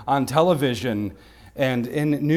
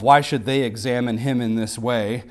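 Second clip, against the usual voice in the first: ordinary delivery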